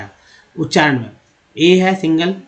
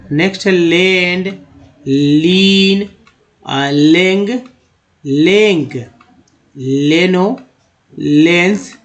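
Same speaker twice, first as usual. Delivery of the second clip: louder, deliberate reading of each practice word; teacher reading a drill list; 3 dB louder, much slower than usual. Acted. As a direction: read each word out slowly for learners to repeat.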